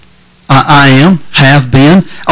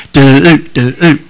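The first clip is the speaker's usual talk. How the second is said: normal talking voice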